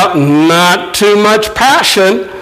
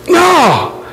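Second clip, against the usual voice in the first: ordinary talk, nothing marked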